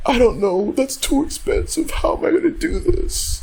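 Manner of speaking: very high whiny mock complaint; whiny homeowner. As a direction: pitch way up, worried whine. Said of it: "they always be like".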